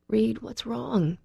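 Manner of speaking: dreading. A narrator's reading. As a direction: ask slowly, dreading the answer. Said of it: "dreading his response".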